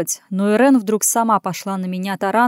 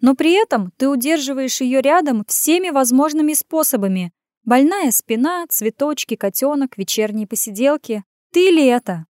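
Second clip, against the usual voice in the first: higher voice, emphatic reproach; scornful needling woman; 5 semitones higher than usual. Acted. Woman listing his excuses to keep her near, scornful. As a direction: list the evidence against him, pitched up. Irenka goes on accusing.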